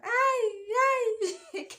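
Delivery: high, loud hammed-up wailing cry; mock wail. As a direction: pretend to howl in comic despair. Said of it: "Wacha nilie kwanza".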